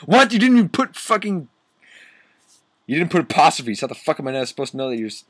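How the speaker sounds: fast, high outburst of disbelief; real exasperation. Real, not acted. Reader fed up with missing apostrophes, exasperated. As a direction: rattle it off in indignation.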